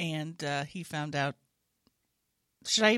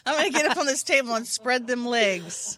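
laughing shout; 4 semitones higher than usual. Real, not acted. Laughing at own joke.

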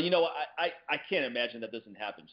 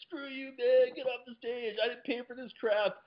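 heckling crowd; 13 semitones higher than usual. Retold or imitated. Hecklers yelling at comic.

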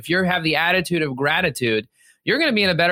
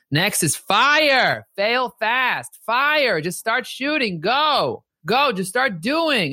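higher, punchy commands; fired-up urging; 7 semitones higher, much slower than usual. Real, not acted. Host urging a sales rep to start now, fired up and high. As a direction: pump him up to just go. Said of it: "urging listener to act now".

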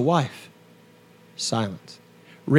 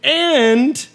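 indignant rant; 5 semitones higher, 4 dB louder, slower than usual. Retold or imitated. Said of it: "And I'm like".

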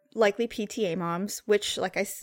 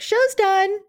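cheery producer voice; 13 semitones higher, 7 dB louder than usual. Retold or imitated. Quoting the producer's cheerful report.

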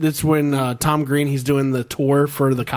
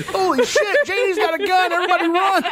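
high-pitched mock alarm, laughing; hammed-up panic warning through giggles; mock panicked cry; 13 semitones higher than usual. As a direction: squeal it high, barely holding back laughter. Acts out movie panic through laughter.

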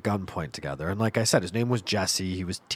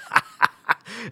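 giggle; 12 semitones higher than usual. Real, not acted. Laughing at the memory.

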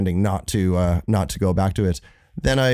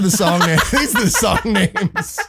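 laughing; 11 semitones higher, 4 dB louder than usual. Real, not acted. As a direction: crack up while insisting it's true. Laughing at old band's song names.

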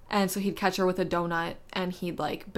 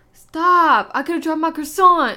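higher, louder mock outrage about the croissant; mock panicked yell; 9 semitones higher, 10 dB louder, slower than usual. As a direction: yell like you nearly dropped it. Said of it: "Number five".

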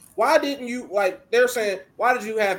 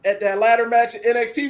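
ordinary talk, nothing marked

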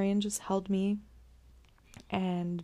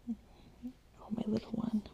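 tender coo; 3 semitones higher, 7 dB quieter, much faster than usual. Real, not acted. Mother softly cooing to her newborn in her arms, tender. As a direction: coo gently over the little one.

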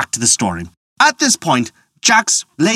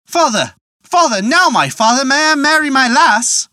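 eager son; 11 semitones higher than usual. A narrator's reading. Son eagerly asking father.

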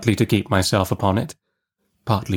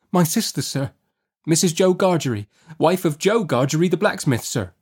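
put-on high, small boy's voice; timid child; 8 semitones higher than usual. A narrator's reading. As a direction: answer politely and scared. Boy answers.

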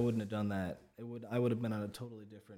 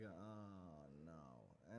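low, very soft "oh no"; hushed retold sense of doom; quiet mock dread; 6 semitones lower, 17 dB quieter than usual. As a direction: let it sink down, barely voiced. Voicing uneasy feeling during trip.

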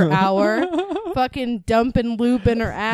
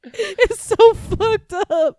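laughing; 9 semitones higher, 5 dB louder, much slower than usual. Real, not acted. Laughing disgust.

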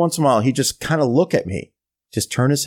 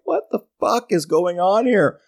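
higher, baffled inner complaint; bewildered exasperation; 7 semitones higher than usual. Retold or imitated. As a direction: recap the disbelief, pitch raised. Recapping his bewilderment.

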